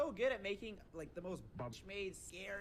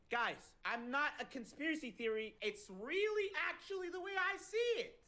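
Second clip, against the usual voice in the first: mocking; 8 semitones higher, 4 dB louder than usual. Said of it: "You literally just like".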